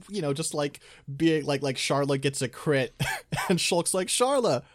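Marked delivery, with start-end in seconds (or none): 4.1-4.6 high-pitched mock battle praise; excited cheer. Shulk praising Sharla after a crit, high excited cheer. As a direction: cheer a teammate in a much higher voice.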